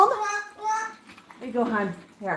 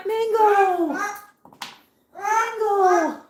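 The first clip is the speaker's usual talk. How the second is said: high, falling singsong call; calling a pet; 7 semitones higher, much slower than usual. Real, not acted. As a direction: call the bird's name high and drawn out. Calling the pet bird by name.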